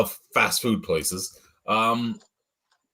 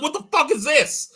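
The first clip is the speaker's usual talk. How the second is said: much higher, mock-outraged squawk; retold outrage; 3 dB louder than usual. Customer horrified by vegan cheese, high mock squawk. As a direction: squeal the question like a horrified customer. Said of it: "I'm like".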